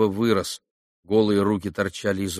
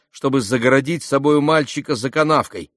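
ordinary delivery, nothing marked

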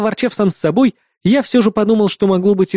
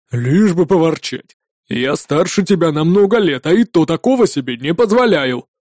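put-on rumbling old man. A narrator's reading. Old armourer scolds the young grumbling customer, rumbling. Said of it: "проурчал старик".

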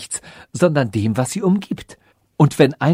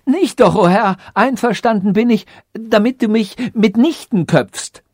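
high-pitched desperate objection; panicky, much higher voice; frantic protest; 9 semitones higher, 4 dB louder than usual. A narrator's reading. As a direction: plead frantically against your execution. Condemned sage protests to king.

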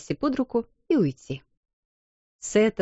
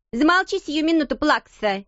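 sharp shout; 8 semitones higher than usual. A narrator's reading. Girl scolding a crying little girl, shouting. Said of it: "прикрикнула на неё Лавиния".

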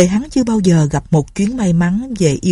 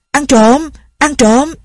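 high, loud alarm cry of thief; loud shout; 8 semitones higher, 4 dB louder, slower than usual. A narrator's reading. Old Nhị shouting thief into the night, a loud high alarm. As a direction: yell it out to rouse the house. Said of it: "lão nhị quát to".

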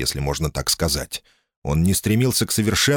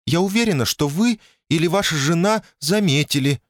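much higher, strained woman's voice; high tense voice; 10 semitones higher than usual. A narrator's reading. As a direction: keep it high and tight. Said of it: "голос у неё был высокий и напряжённый".

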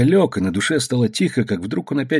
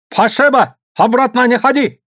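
high, loud order; shouted banishment; 12 semitones higher, 5 dB louder than usual. Acted. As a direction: call harshly after the departing boat. Boss pushes boat away.

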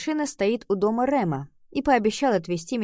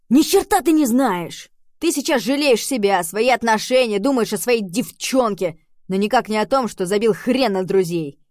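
angry shouting; 3 semitones higher, 6 dB louder than usual. Acted. Rem yells back at Oliver.

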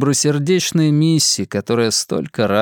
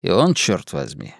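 usual speaking voice

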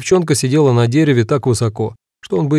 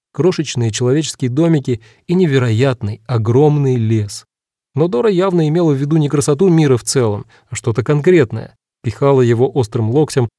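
usual speaking voice

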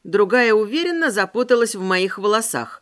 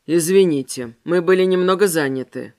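man's voice; 6 semitones lower than usual. Acted. Mechislav voiced in dialogue.

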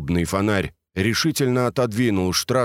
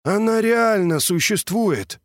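higher, slow, awestruck; amazed; 12 semitones higher than usual. A narrator's reading. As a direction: marvel at it slowly. Vergiliy marvels at the machine.

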